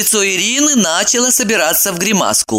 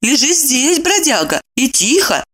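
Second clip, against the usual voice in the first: gentle command; 9 semitones higher than usual. Acted. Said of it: "наказала она".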